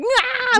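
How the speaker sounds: high mock exasperated "ah"; frustrated cry. Co-host imagining her friend flustered at the gas station, high mock cry. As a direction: imitate her flailing at the pump. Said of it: "I can totally see you at the gas station".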